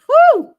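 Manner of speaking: relieved high whew, loud and sharp. Real, not acted. Reader relieved at the cards, big loud whew.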